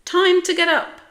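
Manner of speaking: high, loud sing-out call; bright-and-early wake-up call, pitched high. A narrator's reading. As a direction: call loudly to wake a sleeper. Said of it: "she called".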